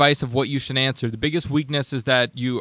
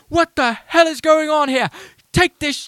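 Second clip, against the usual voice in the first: much higher, louder mock Ramsay yell; Gordon Ramsay yelling; 13 semitones higher, 5 dB louder than usual. Host imitates Gordon Ramsay yelling at restaurant staff, much higher and louder. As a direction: bellow at the kitchen staff. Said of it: "have Gordon Ramsay come in and yell".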